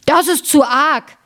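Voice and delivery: höher und langsam, mild gespielter Ausruf; wütender Riese. A narrator's reading. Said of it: "schrie der". (leicht gespielter Riesenschrei)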